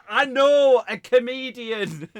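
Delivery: high, loud, drawn-out announcement; retold stage shout. Comic retelling what he shouted to the act, loud call. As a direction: call it out slowly across a room.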